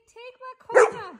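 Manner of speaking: dog's words in a high squeak; lightly voiced dog. Owner voicing her dog's thoughts, high and squeaky.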